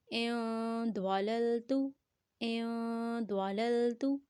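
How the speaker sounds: "این" hummed long before a heavy "دوالل تو"; held nasal ikhfa. Shown as a demonstration. Teacher models noon before daad, held nasal sound. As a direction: hold the n of "این" in the nose, then "دوالل تو". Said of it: "دواد بھی اخفا میں سے ہے".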